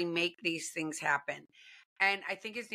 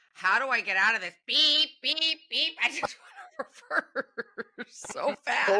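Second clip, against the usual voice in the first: high, loud mock alarm with beeps; comic panic; 5 semitones higher, 4 dB louder than usual. Co-host acts out regretting a yes, comic panic. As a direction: back out in a playful, laughing fluster. Acting out wanting to back out.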